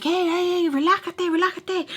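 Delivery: high-pitched flustered placating; imitated freak-out. Mimicking him backing off from her screaming, freaking out. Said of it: "he's, like, freaking out".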